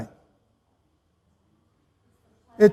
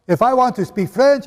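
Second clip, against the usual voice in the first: ordinary talk, nothing marked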